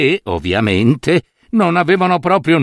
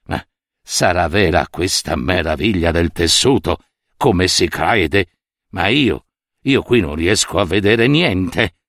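lower, hushed puzzling to himself; a hint of a worried old minister, lower; minister's private doubt; 7 semitones lower than usual. A narrator's reading. Minister staring at empty looms, wondering in a lower voice. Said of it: "ed egli pensò tra sé".

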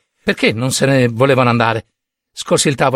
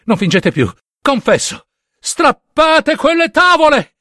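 frenzied confession; 12 semitones higher than usual. A narrator's reading. Killer confessing to the officers, frenzied shout. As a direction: scream the confession, tear it out of yourself.

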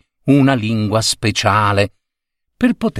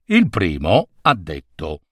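ordinary delivery

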